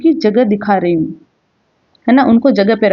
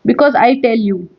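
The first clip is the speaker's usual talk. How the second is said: ordinary talk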